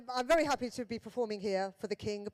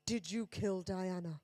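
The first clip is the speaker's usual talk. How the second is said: low, slow, softer aside; hushed confiding question; 4 semitones lower, 5 dB quieter than usual. Retold or imitated. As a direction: drop the voice and ask it slowly. Imagined blunt question to the Queen.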